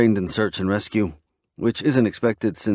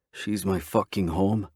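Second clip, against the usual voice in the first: ordinary delivery, nothing marked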